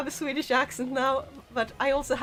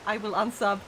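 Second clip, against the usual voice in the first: normal talking voice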